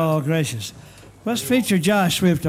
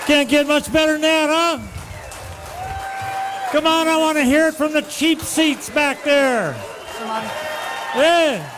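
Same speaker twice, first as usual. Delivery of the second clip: calling out high to the crowd; calling from far away; 7 semitones higher than usual. Real, not acted. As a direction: call out over the cheering. Host calls band back onstage.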